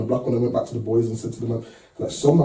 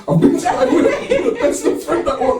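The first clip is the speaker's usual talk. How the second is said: "wedding" said much higher, laughing. Real, not acted. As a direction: burst out laughing on the repeat. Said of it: "repeats it laughing".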